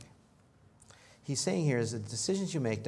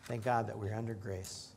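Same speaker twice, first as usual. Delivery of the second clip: usual speaking voice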